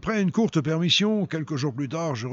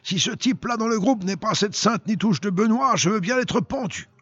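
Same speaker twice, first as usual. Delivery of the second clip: exclaimed outburst; 7 semitones higher than usual. A narrator's reading. Corporal recognising Benoît in the photo, mild outburst. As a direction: exclaim it in a raised voice, short of yelling. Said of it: "hurla-t-il".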